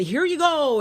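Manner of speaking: high, breezy here you go; a lightly mocked government giving in; mock generous handover. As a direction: hand it over with fake generosity. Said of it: "turn around and say".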